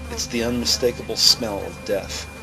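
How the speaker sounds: ordinary talk, nothing marked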